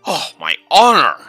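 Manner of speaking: loud, high outraged protest; mock offended. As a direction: clutch your pearls, hammed up. Offended reply to teasing.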